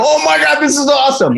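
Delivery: very high, hammed-up excitement; mock-ecstatic exclamation; thrilled. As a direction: gush in a high voice, playing up the thrill. Retold thrilled reply to coach's note.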